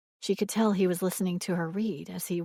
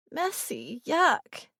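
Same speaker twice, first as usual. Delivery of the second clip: playful; 7 semitones higher, much slower than usual. A narrator's reading. Father playful with child.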